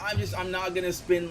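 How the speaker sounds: ordinary delivery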